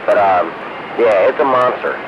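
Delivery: normal talking voice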